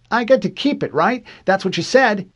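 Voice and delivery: put-on high, loud little-boy voice; excited child pleading his case; insistent young boy. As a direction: press eagerly like a kid claiming a prize. Boy claims his prize.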